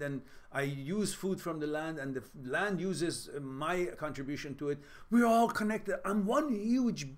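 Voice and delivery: high, emphatic voice of a tree; confident tree. Pretend. Imagining a tree declaring it is one with nature, high and emphatic. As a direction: speak up proudly as a rooted tree. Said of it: "No, that tree says".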